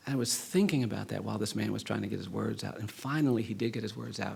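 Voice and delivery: ordinary talk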